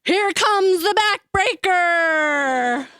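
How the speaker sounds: big brother's mock wrestling call, stretched out; wrestling shout. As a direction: boom it out like a pro-wrestling announcer. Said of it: "lay me over his knee and shout".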